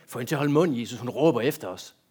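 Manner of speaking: loud, exasperated plea to shut her up; annoyed complaint. Acted. Disciples begging Jesus to silence the woman, annoyed. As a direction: complain loudly, fed up with the noise.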